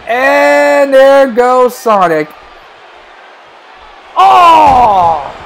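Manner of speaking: slow, drawn-out call ending in a loud cry; excited play-by-play. Real, not acted. Commentator watching Sonic go, drawn-out excited cry. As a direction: stretch the call, then cry out. Calling a big hit.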